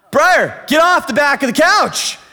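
put-on loud, high yell at a child; exasperated parental yell. Dad to daughter who keeps asking what he said, exasperated loud yell. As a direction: lose patience and yell the order out. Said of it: "Scream it in my ear".

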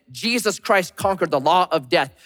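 ordinary talk, nothing marked

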